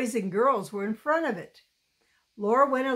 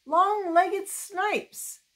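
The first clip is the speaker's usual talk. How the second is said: high, slow jeering taunt; boy yelling; 9 semitones higher, much slower than usual. A narrator's reading. A freckled boy jeers at the new girls, high and slow. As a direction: sing it out to mock the girls.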